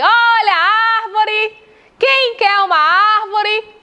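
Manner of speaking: loud, high hawker's cry; street vendor's call. Shown as a demonstration. Teacher pretends to sell a word to find its stress, vendor's call. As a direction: call out your wares, drawing out the stressed vowels. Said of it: "eu vou ter que vender".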